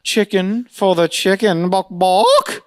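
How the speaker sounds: high, slow waiter gag ending in clucks; hammed-up voice with mock chicken clucks; waiter impression. Waiter serving the chicken, hammed-up high clucking voice. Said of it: "And he goes".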